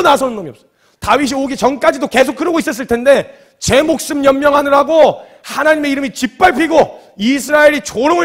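high, very loud indignant sermon; impassioned preaching. Real, not acted. Preacher decrying that nobody stood up for God, impassioned shouting. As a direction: preach at full force.

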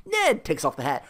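normal talking voice

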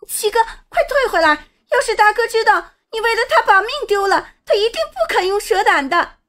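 put-on high-pitched, desperate plea from a girl; frantic yelling. A narrator's reading. Sister begs brother to retreat from danger, frantic high cry. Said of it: "小五气急败坏的大叫，".

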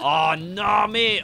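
loud, rising cry of dismay; real exasperated outburst; dismayed outcry. Real, not acted. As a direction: groan it out loudly at bad luck. Dismay at rain in game.

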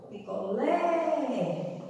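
Picolé with LÉ long and open; stress on last syllable. Shown as a demonstration. Teacher showing where the acute accent goes, LÉ drawn out. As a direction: stretch the final "lé" of "Picolé". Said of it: "acento agudo".

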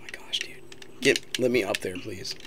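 hushed whisper. Real, not acted. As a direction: keep it under your breath. Sneaking past a threat.

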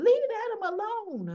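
high, loud leave him alone; shouted demand. Retold or imitated. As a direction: burst in and yell it. Jocelyn bursts in defending Adam.

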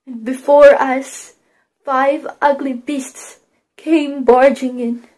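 usual speaking voice

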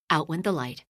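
ordinary delivery

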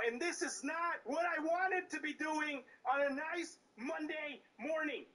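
normal talking voice

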